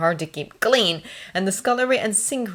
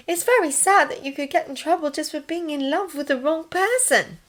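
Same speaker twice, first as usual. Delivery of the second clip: put-on higher-pitched voice for the young nurse; sympathetic nurse; 11 semitones higher than usual. A narrator's reading. Nurse consoles Thomas over forbidden love, sympathetic. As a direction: lift the voice, offering sympathy.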